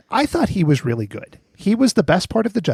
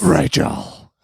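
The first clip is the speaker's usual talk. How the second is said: growled superhero impression; Batman; 3 semitones higher than usual. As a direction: growl the name like a masked vigilante. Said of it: "to do that voice".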